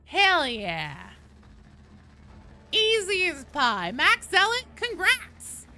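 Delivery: high, loud cheer; bright-spirited shout of praise; excited congratulations. Real, not acted. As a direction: hype up the winners at full voice. Congratulating players after a win.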